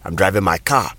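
Ghanaian English, with car said as "ka"; Ghanaian English accent. Shown as a demonstration. Teacher demonstrating a sentence, Ghanaian English accent. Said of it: "in the Ghanaian English accent".

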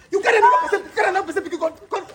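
put-on husband accusing his wife of carrying another's child; angry accusing shout. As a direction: shout it at her with a bitter laugh.

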